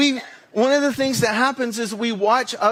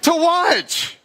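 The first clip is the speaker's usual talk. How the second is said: frustrated outburst; 8 semitones higher than usual. Retold or imitated. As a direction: vent at the empty Netflix menu. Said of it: "I'm like".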